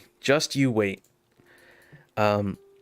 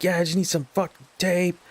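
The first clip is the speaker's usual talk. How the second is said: higher-pitched mock demand; pitched-up, impatient quote; mock irritated customer; 7 semitones higher than usual. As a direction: imitate an annoyed customer cursing. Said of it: "clarify the kind of language he was using".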